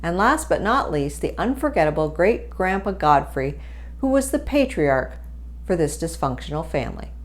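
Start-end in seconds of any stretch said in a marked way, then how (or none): none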